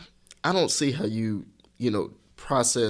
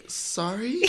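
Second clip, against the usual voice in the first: questioning sorry; 12 semitones higher, 3 dB quieter than usual. Retold or imitated. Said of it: "She was like".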